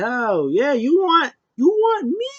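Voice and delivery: high, taunting mock voice; imitating Sasha. Vlogger imitating Sasha taunting Shayna, high and cocky. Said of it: "She was like".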